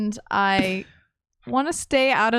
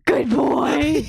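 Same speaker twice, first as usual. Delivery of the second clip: talking to a dog; 4 semitones higher, 4 dB louder than usual. Acted. Praising the dog.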